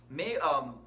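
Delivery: ordinary delivery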